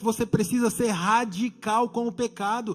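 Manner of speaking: loud, emphatic exhortation; forceful preaching. Real, not acted. Preacher urging church against sin, loud forceful preaching.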